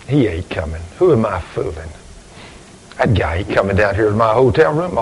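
low and soft, giving up; dejected. Retold or imitated. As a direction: mutter it to yourself, defeated. Dejected, sure the man won't come.

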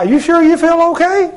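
high, rising worried question; feigned concern. Retold or imitated. Prankster telling a coworker she looks unwell, mock concern. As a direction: ask with exaggerated doubt, pitched right up.

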